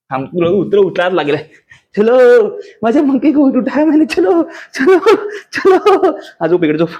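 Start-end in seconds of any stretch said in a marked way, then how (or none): 2.0-6.2 high, loud, laughing singsong; excited play voice climbing high; playful cheering. Real, not acted. Playfully carrying child to bed.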